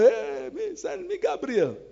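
high, pushy mock plea for an angel; a needy caller ordering up Gabriel, hammed up; mock demand. Mocking people demanding an angel.

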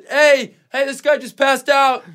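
mock stranger calling out for help. Stranger calling out to others that he passed out.

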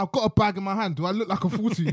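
a hint of the shop row, voice lifted. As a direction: raise your voice at the cashier. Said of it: "I started to raise my voice".

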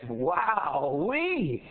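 high, drawn-out marvelling; amazed farmer. Acted. Farmer spotting a flying frog, amazed. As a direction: stretch the words out in hammed-up wonder. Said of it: "I am amazed".

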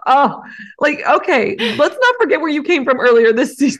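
high, loud outraged squeal; squeal volume. Real, not acted. Defending Ferrari to co-host in a squealing outraged voice. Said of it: "You've activated squeal volume".

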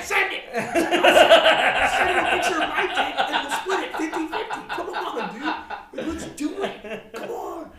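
high, loud, slow, laughing shout. Retold or imitated. Urging his brother to send the picture, laughing shout. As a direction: yell it like you're urging him on.

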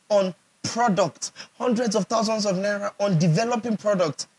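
usual speaking voice

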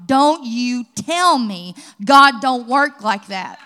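loud, slow and defiant; preaching shout. Real, not acted. Pastor proclaiming God's provision loudly. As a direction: hammer each word home to the crowd.

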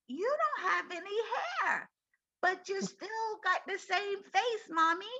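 put-on high-pitched little boy voice; Benjamin. Four-year-old son to his bald mother, imitated child voice. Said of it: "I remember Benjamin coming up to me".

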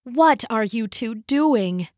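plain reading voice

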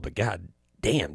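ordinary talk, nothing marked

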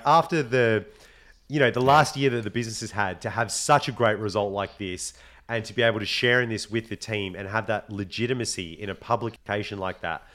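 ordinary talk

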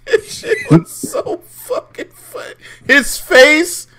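high, wheezing words through laughter; real breathless laughing fit; helpless laughter. Real, not acted. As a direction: crack up while trying to talk. Cracking up at the retold moment.

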